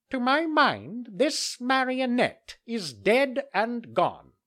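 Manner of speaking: high, loud, slow pompous crow doctor; solemn crow. Acted. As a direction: pronounce it like a grave bird doctor. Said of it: "solemnly pronounced the following words".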